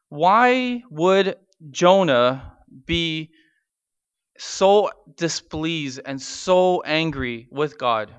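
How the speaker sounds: very slow, drawn-out wondering question; slow emphatic question. Acted. Pastor puzzles aloud before the congregation, drawn out slowly. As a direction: stretch each word to make them wonder.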